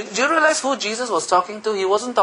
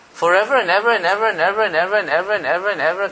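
fast breathless repetition of ever; rattling on; much faster than usual. Shown as a demonstration. Preacher shows forever, fast and breathless. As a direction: rush the and evers together. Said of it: "I need to catch my breath".

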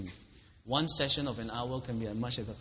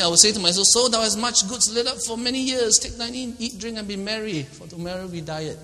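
loud, high, self-satisfied cheer; gleeful boast; 9 semitones higher, 8 dB louder than usual. Acted. Preacher acting the rich man, loud and gleeful. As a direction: crow it like a man toasting his riches.

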